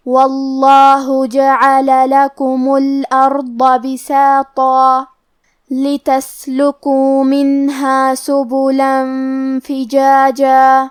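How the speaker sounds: slow, melodic tajweed chanting; Quran recitation. Shown as a demonstration. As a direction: draw out each vowel in recitation.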